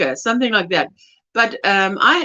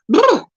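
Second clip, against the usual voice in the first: shivering brr; 7 semitones higher, 4 dB louder than usual. Real, not acted. Shivering at cold.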